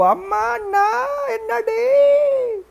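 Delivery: very high, long drawn-out wail; mock wailing. Wife wails over the smashed idol, mock wailing. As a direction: stretch each word into a squeal.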